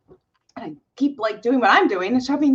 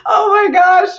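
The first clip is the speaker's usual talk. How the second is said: exasperated exclaim; 8 semitones higher, 6 dB louder than usual. Real, not acted.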